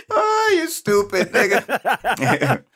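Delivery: slow, high, through tears of laughter; laughing crying. Real, not acted. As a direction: gasp it out while cracking up. Laughing after joke.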